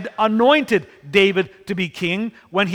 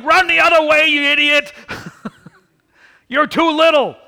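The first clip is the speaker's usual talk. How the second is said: higher, louder mock shout from the hill; mock yell; 7 semitones higher, 4 dB louder than usual. Brothers on the hill yelling after their little brother, a mock shout. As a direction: call out to him like a panicked big brother.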